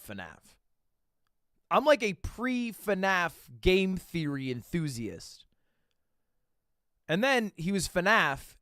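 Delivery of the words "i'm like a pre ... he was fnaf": ordinary talk, nothing marked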